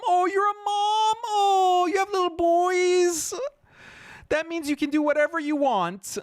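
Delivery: very high, hammed-up fake crying voice; mocking whiny mother. Mocking a mother's emotional plea in fake crying falsetto. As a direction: whimper it like a fake-tearful mom. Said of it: "just because you're a mom".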